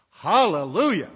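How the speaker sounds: loud, relieved hallelujah; joyful exclamation. Real, not acted. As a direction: cry out in relief. Finally reaching a human.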